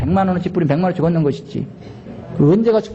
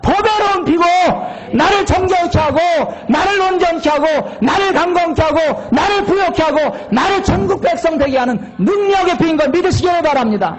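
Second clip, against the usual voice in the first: preaching at full force; 11 semitones higher than usual. Acted. Pastor shouting the blessing to the congregation, full force. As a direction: cry it out over the congregation.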